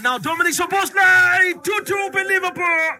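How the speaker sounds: high, loud burst of goal commentary; goal shout. Real, not acted. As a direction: roar the equaliser to the crowd. Penalty goal, commentator erupts.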